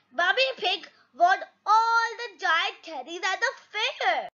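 usual speaking voice

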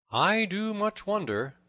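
high, slow, drawn-out musing; musing to himself. A narrator's reading. Little John wonders about the peering stranger, musing aloud. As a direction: wonder aloud, pitched well up. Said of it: "quoth Little John to himself".

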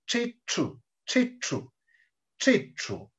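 "Chichu" with the tongue curled back on each ch; careful, separate "Chichu" with retroflex ch. Shown as a demonstration. Teacher showing how the letter sounds, retroflex ch. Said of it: "Así suena la retrofleja".

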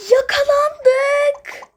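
high gasp then slow dread; gasp, then drag out the bad news; caught gasp. Kids caught in someone's room, a frightened gasp.

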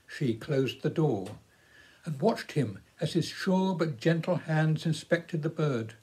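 plain reading voice

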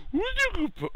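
put-on loud, sky-high falsetto baby talk; high puppy praise. Player praising the puppy, loud high falsetto.

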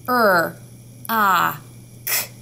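Er, ah, k spaced apart; sounding out rock. Shown as a demonstration. Teacher to class, segmenting rock sound by sound. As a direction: model each sound of rock. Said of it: "Tell me the sounds of rock".

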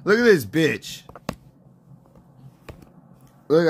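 normal talking voice

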